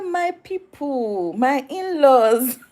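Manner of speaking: high, long drawn-out joyful cry; mock delighted greeting. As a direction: hail long-lost family, stretching each word. Acts South Africans greeting their in-laws.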